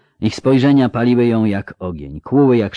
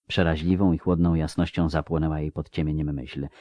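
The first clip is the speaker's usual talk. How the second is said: ordinary delivery, nothing marked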